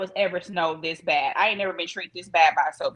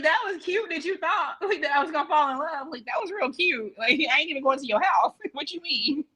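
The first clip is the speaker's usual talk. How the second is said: higher, mocking disbelief with laughs; mocking amused quote; 6 semitones higher than usual. Mocking her date's smug claim, higher and laughing. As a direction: scoff at his smug line, laughing. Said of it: "She was like".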